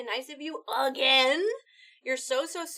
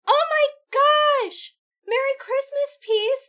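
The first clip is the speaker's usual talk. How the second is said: much higher, loud and thrilled; excited squeal; 11 semitones higher, 7 dB louder than usual. Real, not acted. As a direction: shriek with delight. Big donation arrives.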